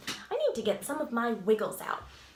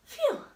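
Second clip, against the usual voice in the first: relieved phew. Real, not acted. Done wiggling.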